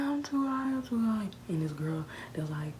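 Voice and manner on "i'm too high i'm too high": high whiny repeat; mock panic. Her high whining complaint about being too high. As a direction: whine it twice. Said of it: "I was like".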